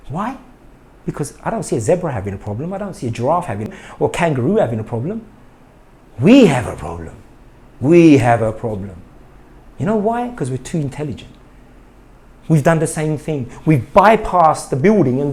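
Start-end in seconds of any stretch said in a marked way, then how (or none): 6.2-9.0 deep, heavy, louder warning; dramatic pronouncement. Acted. Dramatic pronouncement after animal examples.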